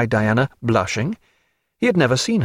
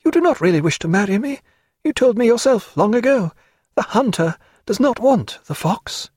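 high, lightly tearful woman's voice; between sobs; 10 semitones higher than usual. A narrator's reading. Diana crying on his shoulder, refusing him, tearful. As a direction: push through sobs in a high voice. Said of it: "she said between her sobs".

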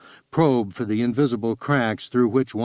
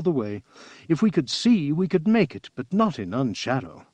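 higher character voice than the narration; Estervan, sober companion; 5 semitones higher than usual. Acted. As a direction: weigh up the route in a high, measured voice. Companion's dialogue in narrated story.

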